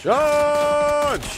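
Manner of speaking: high, loud charging yell; battle cry. Pretend. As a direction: roar it like leading an army. Caster mimics battle cry.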